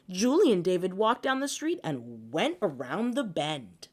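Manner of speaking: plain reading voice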